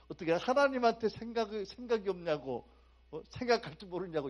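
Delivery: mock outrage.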